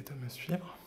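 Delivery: ordinary talk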